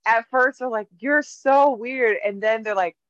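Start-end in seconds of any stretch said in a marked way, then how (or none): 1.0-2.2 slow, higher teasing "so weird"; mock coworker. Coworkers mocking her.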